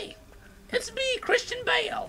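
squeaky high, hammed-up British "Oi" voice.

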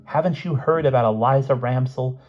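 a touch of sharp reproach; snapped. A narrator's reading. Lavinia rebukes the prankster, snapping mildly. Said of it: "snapped Lavinia".

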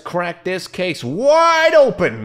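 loud, slow, drawn-out hype line; psyched gamer kicking off the case; hyped announcement. Real, not acted. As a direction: sell it like a trailer voice-over. Hyping the game start.

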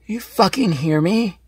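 loud, confrontational outburst; aggressive yelling. Acted. Unhinged guest confronting her interviewer, angry yelling. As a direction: yell a challenge, stretching the last question.

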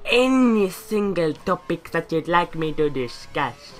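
slow, drawn-out goofy character voice; lightly voiced silly persona, slowed right down; silly put-on voice. Vlogger asking viewers for topics in a slow silly voice. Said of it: "Why I did that voice".

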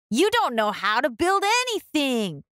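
teasing brothers' taunt; mocking brothers. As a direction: scoff at her like big brothers. Brothers mock Ruby.

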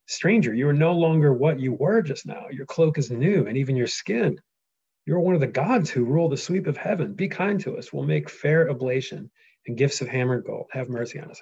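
lightly voiced Telemachus, awed; awed son pleading. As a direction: beg the god for mercy. Reads Telemachus' awed plea.

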